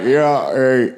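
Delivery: low, creepy put-on greeting; deep, unwelcoming weirdo voice; Buffalo Bill. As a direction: answer like an off-putting creep. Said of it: "taking on that voice like Buffalo Bill".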